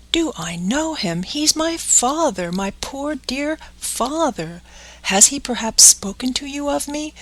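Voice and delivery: lightly voiced boy, pleading and rising; anxious puppet boy. Pinocchio begging the pigeon about his father, eager and pleading. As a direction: beg for news of his father.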